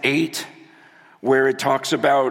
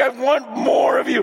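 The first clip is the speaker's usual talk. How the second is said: fervent plea; 9 semitones higher than usual. Retold or imitated. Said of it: "It's like".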